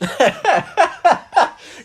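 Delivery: loud, high real laugh; burst of laughter. Real, not acted. Hearing her co-host's joke, a real loud laugh. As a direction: crack up out loud.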